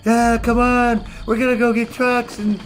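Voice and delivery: high, loud, eager mock teammate voice; hammed-up clueless teammate; mocking eager newbies. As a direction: play the overexcited newbie. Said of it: "They're like".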